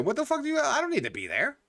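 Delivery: high, incredulous mock protest; mock outraged squeal. Telling off a friend who asks where he went, high mock outrage.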